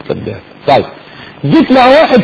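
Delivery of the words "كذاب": كذاب almost whispered, much softer and lower; barely voiced كذاب said to yourself; near whisper. Shown as a demonstration. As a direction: breathe كذاب as a hidden inner judgment. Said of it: "بس من جوه قوي قوي".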